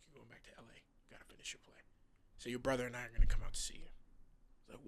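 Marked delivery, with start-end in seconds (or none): 0.1-1.8 retold dad's hushed insistence, barely audible; whispered quote. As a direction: drop to a whisper, imitating a firm father. Retelling dad's solemn instruction after funeral.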